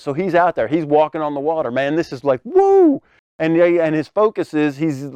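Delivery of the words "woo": high-pitched exhilarated "woo"; excited whoop. Acted. Preacher imagines Peter walking on water, excited whoop. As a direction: let out a mock-thrilled whoop.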